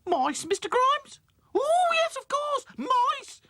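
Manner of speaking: very high, loud flustered cry. Acted. Florrie suddenly remembering the mice. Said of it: "cried Florrie Fosdyke".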